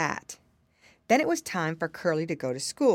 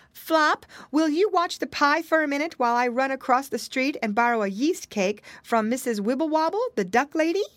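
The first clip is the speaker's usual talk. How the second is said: high, louder mother calling; calling mama pig; 7 semitones higher, 4 dB louder than usual. A narrator's reading. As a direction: call out to him from across the kitchen. Mama calls Flop.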